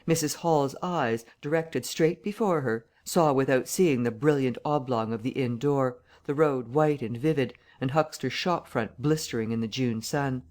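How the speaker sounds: ordinary delivery, nothing marked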